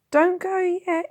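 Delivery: put-on high, loud desperate child's plea; cling to him and beg; urging boy. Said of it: "he urged".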